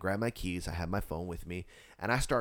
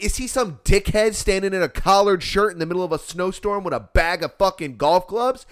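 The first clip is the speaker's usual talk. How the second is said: loud, high, heated question; exasperated sarcasm; 7 semitones higher, 6 dB louder than usual. Retold or imitated. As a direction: act out a fed-up rant. Acts out sarcastic angry reply.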